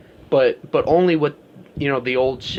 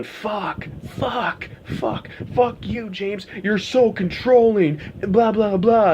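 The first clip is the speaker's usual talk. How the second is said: high, whiny mock tantrum voice; 7 semitones higher, slower than usual. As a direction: sneer it like a spoiled child.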